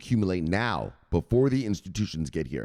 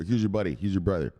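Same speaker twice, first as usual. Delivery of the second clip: ordinary talk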